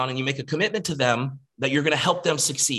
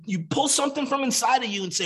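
usual speaking voice

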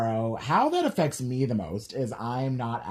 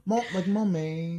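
mock British accent; 4 semitones higher than usual. Shown as a demonstration. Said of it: "three things in a British accent".

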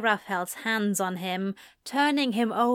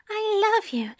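high whispered love line between kisses; airy, high whisper of love; breathless whisper; 12 semitones higher, much faster than usual. A narrator's reading.

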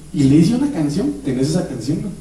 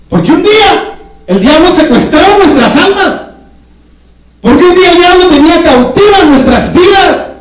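alto y fuerte, gritando el mensaje; predicación intensa; 10 semitones higher, 5 dB louder than usual. Acted. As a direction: clama con fuerza al pueblo. Predica a todo pulmón. (predicador clama a la congregación, a todo pulmón)

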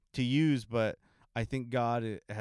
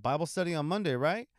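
higher, louder upbeat question; eager invitation; 6 semitones higher, 4 dB louder than usual. Retold or imitated. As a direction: pitch the invite brightly to her. Inviting aunt to Bible study.

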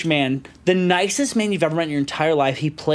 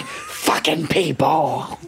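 slow, drawn-out grumbled curse; mock exasperation; 3 semitones lower, much slower than usual. Old dog owner cursing after searching, exasperated curse. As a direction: let it sag out very slowly, fed up.

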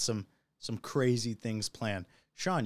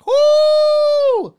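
very high, loud "woo"; post-shot whoop; 15 dB louder than usual. Real, not acted. Host downing a tequila shot, whooping. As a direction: let out a piercing whoop. Reacting right after a shot.

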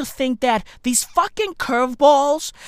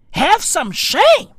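mock indignation; 7 semitones higher, slower than usual. Repeats the rebuke higher and slower.

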